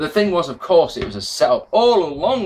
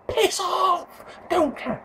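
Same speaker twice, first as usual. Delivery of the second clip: much higher, mock-shrieked dismissal; high squeaky brush-off; 5 dB quieter, much slower than usual. Viewer mock-shrieking at a wrestling fan. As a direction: squeal it off like a fed-up kid.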